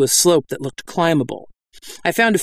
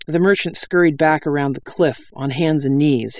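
ordinary delivery

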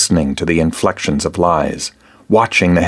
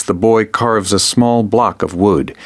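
plain reading voice

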